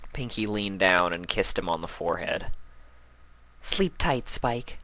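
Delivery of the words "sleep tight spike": usual speaking voice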